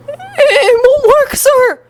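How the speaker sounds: high, drawn-out panicked cry; yelling back. A narrator's reading. Student telling his teacher the song fails, high panicked yell. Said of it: "he yelled back".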